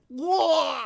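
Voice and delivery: high, loud startled whoa; surprised yelp. Real, not acted. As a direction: yelp in shock at what you see. Startled by own neck on camera.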